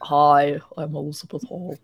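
low, put-on deep voice; Elizabeth Holmes. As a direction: drop into a mock deep voice. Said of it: "deep voice".

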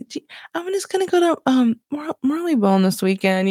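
high, quick start, then hesitating at the name; skimming over it. Pretend. Telling weekend plans while unsure of Marylebone, skimming over it. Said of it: "I have to, like, skim over it".

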